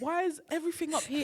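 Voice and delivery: high, laughing tease. Retold or imitated. Teasing a friend's high accent, high and laughing.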